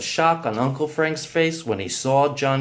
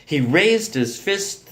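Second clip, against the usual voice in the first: ordinary delivery, nothing marked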